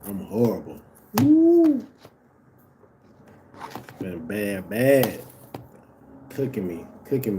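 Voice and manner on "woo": very high, loud whoop; loud "woo". Real, not acted. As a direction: let out a piercing falsetto woo. Exclaiming over losing at games.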